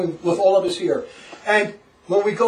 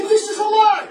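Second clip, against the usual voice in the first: high-pitched mock outcry; retold indignant protest; 12 semitones higher, 3 dB louder than usual. Pupil objecting to the catechism, high retold protest. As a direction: pitch it way up in recalled disbelief.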